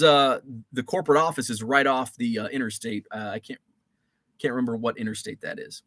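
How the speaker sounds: ordinary talk, nothing marked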